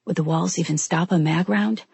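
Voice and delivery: ordinary delivery, nothing marked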